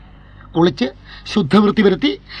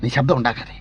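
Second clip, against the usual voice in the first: much softer and lower, keeping quiet; hushed warning; 10 semitones lower, 11 dB quieter than usual. Acted. Man warns his girl to stay silent, hushed. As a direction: say it under your breath.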